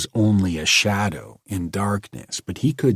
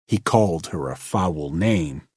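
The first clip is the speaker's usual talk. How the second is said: ordinary delivery, nothing marked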